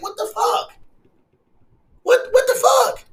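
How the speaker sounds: high-pitched, incredulous outburst; exasperated disbelief. Real, not acted. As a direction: pitch leaps up in sheer disbelief. Exasperated disbelief at petty killings.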